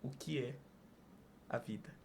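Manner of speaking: lightly imitating a solemn interviewer, each word apart; Antônio Abajurama's question again. Host repeats the big question to the guest, low and hushed. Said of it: "ele perguntava".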